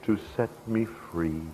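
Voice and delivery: slow, halting, acted sob fading soft; tearful sobbing. A narrator's reading. Freed woman at her buyer's boots, slow acted sobbing. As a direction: narrate it as if choked by tears, drawn out. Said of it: "through her tears she sobbed".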